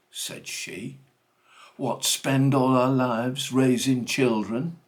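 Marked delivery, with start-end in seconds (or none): 1.8-4.8 loud, indignant outburst, high and slow on bloody lions; blazing mother. Acted. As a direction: let the mother explode in fury at the magistrate. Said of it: "Mother got proper blazing".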